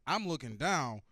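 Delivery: ordinary talk, nothing marked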